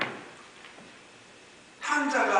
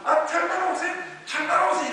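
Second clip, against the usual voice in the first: high, louder coaxing call; raised, pitched-up "come along" voice; servant calling along; 14 semitones higher, 7 dB louder than usual. Acted. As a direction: act a servant urging someone to follow. Acted servant calling to the lame.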